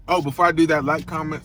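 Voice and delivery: usual speaking voice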